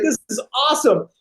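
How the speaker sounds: high, quick, delighted; acted excitement. Actor thrilled about his new film role, excited. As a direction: gush over the good news. Retells joy at getting part.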